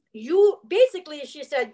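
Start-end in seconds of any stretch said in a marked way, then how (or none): 0.1-0.6 pointed You, louder and higher; young therapist confronting bluntly; loud accusing you. Retold or imitated. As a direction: jab the word You. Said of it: "She was like".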